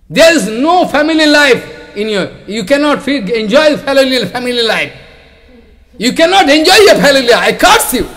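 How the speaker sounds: high, loud angry curse; laying a curse. Retold or imitated. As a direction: hurl the curse in rage. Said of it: "some Brahmin become very angry".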